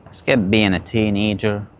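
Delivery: usual speaking voice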